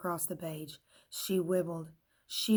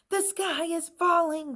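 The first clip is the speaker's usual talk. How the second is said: high, louder panicked cry; 10 semitones higher, 7 dB louder than usual. A narrator's reading. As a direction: cry out in alarm. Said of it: "cried Pencil".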